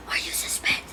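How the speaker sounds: hushed, whispered imitation of a friend; soft retold whisper; whispered question. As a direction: whisper it as if asking on the sly. Joe asking privately in the office.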